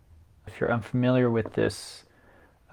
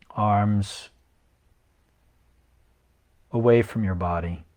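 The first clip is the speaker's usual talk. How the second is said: even script reading with long pauses; guided meditation. Acted. Yoga teacher reading Yoga Nidra to resting listeners, guided meditation. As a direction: guide listeners unhurriedly, leaving long silences between phrases.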